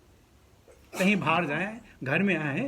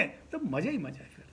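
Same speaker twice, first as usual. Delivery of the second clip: ordinary talk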